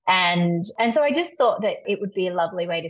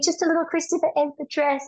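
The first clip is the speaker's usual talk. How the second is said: pleading; 12 semitones higher than usual. Retold or imitated. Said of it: "I'm thinking".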